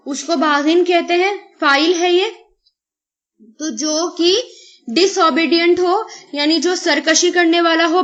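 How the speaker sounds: normal talking voice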